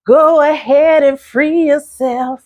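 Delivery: high, slow squeaky diva voice. Retold or imitated. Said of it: "She's got an interesting voice".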